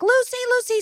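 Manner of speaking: high mock scream of a name; frantic calling. Nanny searching for the missing child, high frantic mock calling. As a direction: shriek the name like a panicked nanny.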